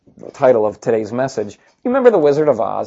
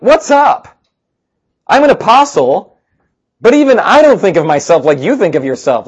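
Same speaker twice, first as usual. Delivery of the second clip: exasperated; 6 semitones higher, 4 dB louder than usual. Retold or imitated. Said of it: "He's like".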